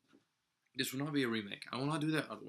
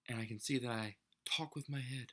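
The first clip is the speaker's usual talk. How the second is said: ordinary talk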